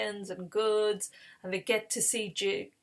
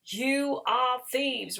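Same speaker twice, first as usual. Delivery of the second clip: gasp, then slow, drawn-out accusation; aghast, stretch the words out after a gasp; scandalised retold accusation; shocked accusation; 4 semitones higher, much slower than usual. Servants find the stolen cup.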